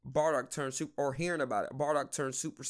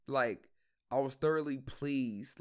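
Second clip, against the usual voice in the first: normal talking voice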